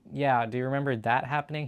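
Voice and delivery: normal talking voice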